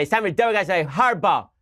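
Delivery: a hint of a TV host hamming it up; mocking Chris Matthews. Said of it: "You could have Chris Matthews go out there and say".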